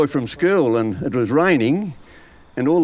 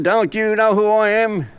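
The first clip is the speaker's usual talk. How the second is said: higher, slower menacing devil; a lightly voiced devil, pitched up and slowed; 7 semitones higher than usual. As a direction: loom over him as the devil. Devil confronting the churchgoer.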